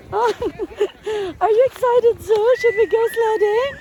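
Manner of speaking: high, loud, eager voice for the dog; excited dog talk. Real, not acted. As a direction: pump the dog up with a high sing-song voice. Excited owner talks to her dog.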